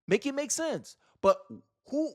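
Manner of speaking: usual speaking voice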